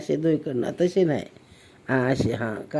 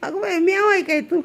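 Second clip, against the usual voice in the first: much higher, pleading with the cat; 11 semitones higher than usual. Real, not acted. Mother pleads with the cat not to bite her.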